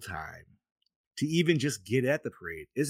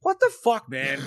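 stunned disbelief; 6 dB louder than usual. Real, not acted. Host hearing the no-ticket trick, loud amazed shriek.

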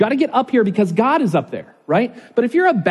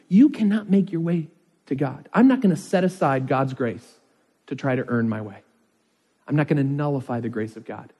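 ordinary delivery

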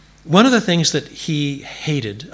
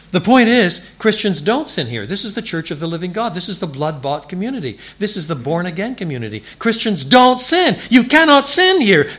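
rising, louder and higher to a thundering close; preaching at full force; 9 semitones higher, 7 dB louder than usual. Acted. Preacher to his congregation, building to a loud, high thundering climax. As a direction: hammer each line home, building.

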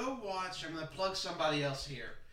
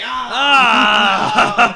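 loud fake scream at the trailer; hammed-up shout of dismay; mock horrified shout; 4 semitones higher, 24 dB louder than usual. Retelling friends reacting to trailer.

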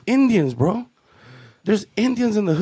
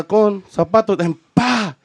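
ordinary delivery

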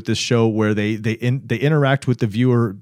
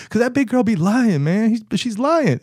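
put-on much higher, whiny blaming kid; pitched-up childish accusation; little boy voice; 10 semitones higher than usual.

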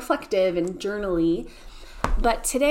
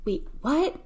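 puzzled, higher-pitched double take; baffled reaction; 7 semitones higher than usual. Retold or imitated. Kids in the back seat react to the sign, baffled. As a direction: retell a kid's confused "wait, what?". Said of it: "And we were like".

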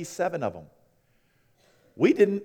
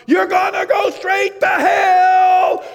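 much higher, much louder, slow mock child's scream; 11 dB louder, much slower than usual. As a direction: belt it out like a kid in a grocery store. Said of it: "screaming at the top of his lungs".

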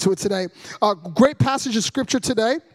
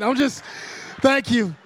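normal talking voice

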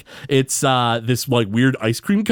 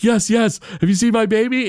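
imitating frantic father; 9 semitones higher than usual. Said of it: "And Homer's like".

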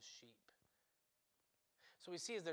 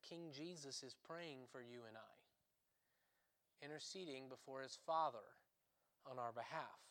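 hushed reverent; 3 semitones lower, 7 dB quieter than usual. Real, not acted. Jesus interceding for us now.

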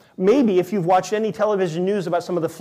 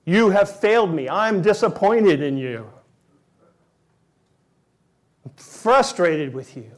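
stern rejection; 4 dB louder than usual. Pretend. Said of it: "What we expect to hear is".

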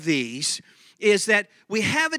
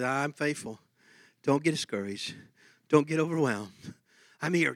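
soft, hushed voice of comfort; gentle reassurance; 5 semitones lower, 7 dB quieter than usual. Retold or imitated. Pastor voicing the Holy Spirit comforting him, soft and gentle. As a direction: speak it quietly, like a kind whisper of reassurance.

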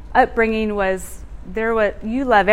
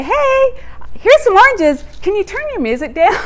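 high, bright put-on friendly voice, quick at the end; sugary pitch to the neighbor; sweet neighborly request; 10 semitones higher, 4 dB louder than usual.

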